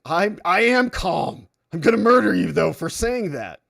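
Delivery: boiling mad. Acted. Boiling-mad person answering "calm down", acted fury. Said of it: "When you're boiling mad".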